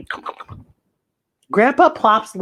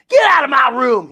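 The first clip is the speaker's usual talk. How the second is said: put-on louder, higher angry teen shout; 5 semitones higher, 5 dB louder than usual. Teen brother shouting his sister out of his room. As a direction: yell like a grumpy teenager. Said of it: "he shouts".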